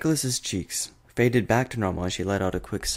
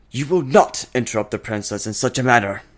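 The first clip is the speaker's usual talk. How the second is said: louder, indignant advisor scolding; stern scolding; 3 semitones higher, 7 dB louder than usual. A narrator's reading. The advisor scolds Discord for interrupting, indignant and loud. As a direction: put the intruder in his place.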